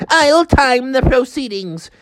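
high, slow officious character voice. Acted. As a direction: announce it slowly in a high storybook voice.

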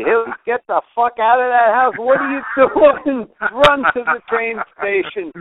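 loud, high, alarmed orders; urgent imitated shouting. Friend on the phone ordering him out of the house, shouted.

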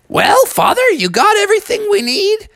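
very high falsetto mama voice; Mother Bear. Acted. Mother checking with Father before leaving, high character voice. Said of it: "Mother Bear said".

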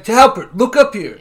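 mock yell. Charles yelling at Jim, mock-stern shout. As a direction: snap to attention, half-laughing. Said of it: "Charles yells at Jim".

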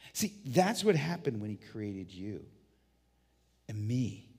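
normal talking voice

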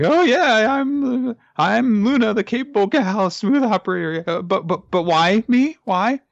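put-on high-pitched parody of a nervous girl boasting; hammed-up Luna. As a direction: squeak it out like a nervous show-off girl. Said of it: "but she's like".

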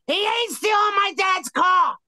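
very high, slow shout; retold defiant yell. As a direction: yell it high and drawn out in protest. Acted yell of angry friend driving.

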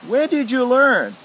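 high, loud, slow amazed question; a client stunned by the massage. Retold or imitated. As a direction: ask it wide-eyed and slow. Client asks in wonder.

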